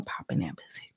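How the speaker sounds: whispered chant line. Retold or imitated. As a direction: drop to a hushed, voiceless aside. Quoting a chant, dropped to whisper.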